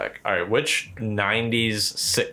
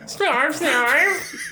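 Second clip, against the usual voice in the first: high squeaky Snarf. Pretend. Mock cat squeal, laughing.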